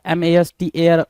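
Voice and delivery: ordinary talk, nothing marked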